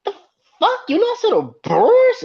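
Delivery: shoots way up high and loud in mock disbelief; a stunned fan who can't believe the loss; imitating incredulous disbelief. Fan re-living the loss to the Brewers, high incredulous squawk. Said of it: "You're like".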